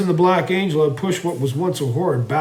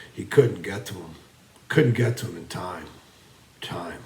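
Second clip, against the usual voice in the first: lower, softer with each phrase; fading despair; 5 semitones lower, 5 dB quieter than usual. A narrator's reading. Narrator on Jen failing to reach him in time, fading hopelessly. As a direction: let the words sink away into helplessness.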